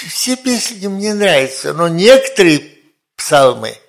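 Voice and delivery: ordinary talk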